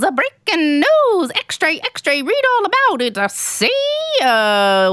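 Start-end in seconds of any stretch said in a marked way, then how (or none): 0.0-3.2 put-on old Hollywood newsreel announcer; transatlantic accent. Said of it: "my breaking news accent".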